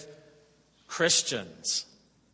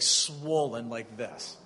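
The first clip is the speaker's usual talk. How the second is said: ordinary delivery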